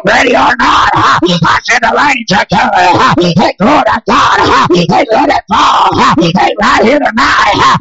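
rising, shouted revival preaching; hoarse-edged revival shout cadence; full-force preaching. Acted. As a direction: preach it hard and build the crowd up. Preacher builds to full cry.

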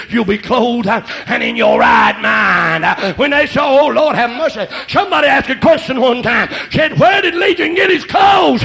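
building to high, loud preaching; full-force preaching. Real, not acted. Preacher tells congregation about Legion, full-force preaching. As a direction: drive it up to a shout.

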